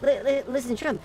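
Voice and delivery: a hammed-up Trump ramble; Trump impression. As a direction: stammer and bluster like Trump on a rant. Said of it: "And they say".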